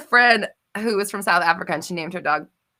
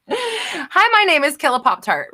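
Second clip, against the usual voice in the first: chirpy mock self-introduction, pitched up; 7 semitones higher, 4 dB louder, slower than usual. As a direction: play-act introducing yourself, high and playful. Acting out a meetup introduction.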